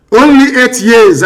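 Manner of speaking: loud, slow, forceful; emphatic shout. Real, not acted. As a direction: drive the point home loudly. Emphatic leader warning.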